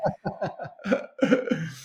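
light amused laugh. Real, not acted. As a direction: chuckle along, amused. Guest laughs with host.

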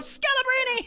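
high-pitched comic scream of a name. Retold or imitated. Friend acts out a woman's outburst in bed, high mock scream. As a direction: cry it out in a very high voice. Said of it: "she just screams out".

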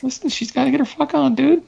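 lightly squeaky impression of Rose brushing it off; mock Rose voice. Friend voicing Rose defending her fling, high mocking voice.

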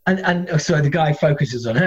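usual speaking voice

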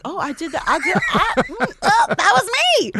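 amazed, rising high; surprised. Retold or imitated. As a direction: act shocked at your own resume. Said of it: "I was like".

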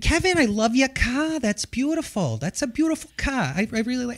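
put-on Boston mom praising Kevin's car in a thick Massachusetts accent. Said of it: "That's the accent".

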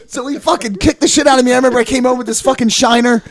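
normal talking voice

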